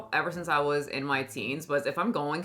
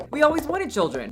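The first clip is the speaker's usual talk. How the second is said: higher, protesting plea; pleading husband; 7 semitones higher, 3 dB louder than usual. Acted. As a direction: plead with a wife who is leaving. Ken pleads with departing Barbie.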